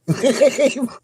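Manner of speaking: short, high burst of cackling; cackle. Real, not acted. Streamer tickled by own soundbite, cackling. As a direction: let out another high cackle. Keeps laughing at own rant.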